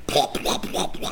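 popping plops in quick succession; mimicked smacks of a soapy washcloth; imitated plopping noise. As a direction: make wet plop sound effects.

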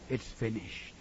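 low, soft whispered words. Acted. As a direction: breathe it to the branches. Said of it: "whispered to the branches".

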